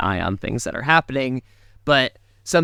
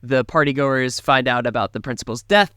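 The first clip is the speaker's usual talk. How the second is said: ordinary talk, nothing marked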